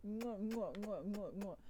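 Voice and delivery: rapid smacky pretend kisses; mock kissing noises. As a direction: smother them in playful kisses. Acting out donkey's kisses.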